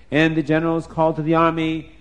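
ordinary delivery

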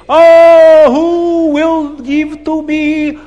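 put-on high, loud, very slow accented bidding cry; Abdallah screaming. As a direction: scream out the auction call. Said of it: "Abdallah is screaming".

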